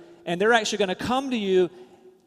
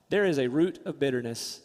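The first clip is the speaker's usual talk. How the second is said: ordinary talk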